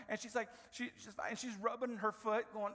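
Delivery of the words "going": ordinary delivery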